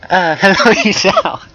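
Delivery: high put-on voice breaking into a laugh; very high, hesitant greeting with a giggle; squeaky-high hello, half laughing; girl character voice. A narrator's reading.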